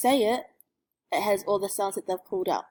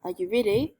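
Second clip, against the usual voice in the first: normal talking voice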